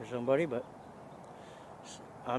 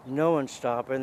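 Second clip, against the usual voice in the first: usual speaking voice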